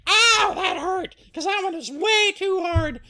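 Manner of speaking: high, loud pained outburst; Goldilocks in pain. Acted. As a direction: cry out high as the hurt little girl. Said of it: "she's like".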